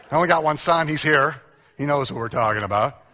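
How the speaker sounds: ordinary talk, nothing marked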